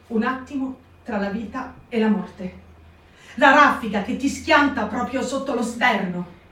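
plain reading voice

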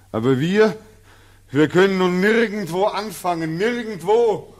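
high, loud, climbing to a shout; loud, rising cry of hopelessness; anguished cry. Acted. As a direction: hurl the last word out in despair. Returnee cries out in despair.

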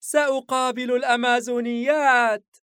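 put-on high, loud little-boy yell; excited child's shout. A narrator's reading. Son flying with arms open, excited shout. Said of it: "وهو يصرخ".

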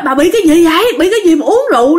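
high-pitched, dismayed retold question; acted husband demanding an explanation; alarmed questioning. Father confronts drunk mother, retold.

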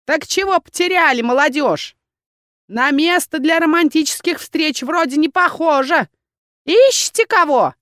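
put-on high, loud old crone voice; nosy crone. A narrator's reading. Old crone quizzing the young visitors, high and loud. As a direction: cackle out questions like a hag.

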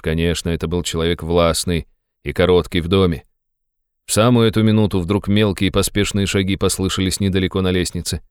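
ordinary delivery, nothing marked

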